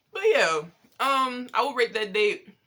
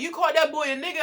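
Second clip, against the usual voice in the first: shocked; 8 semitones higher, 4 dB louder, faster than usual. Retold or imitated. Quoting mom's shocked question.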